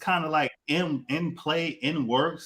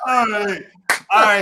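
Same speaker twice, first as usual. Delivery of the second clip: shout; 7 semitones higher, 8 dB louder than usual. Real, not acted. Shouting to restart the show.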